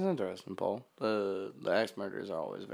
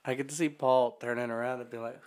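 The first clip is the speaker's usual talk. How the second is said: ordinary talk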